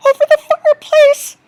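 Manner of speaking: high, loud, fast alarmed cry. Acted. Peter spots the python and cries out in alarm. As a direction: shout the warning in fright.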